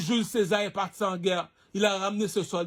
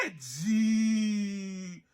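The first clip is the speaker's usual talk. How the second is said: drawn-out call; much slower than usual. Real, not acted. Drawn-out exclamation to the audience.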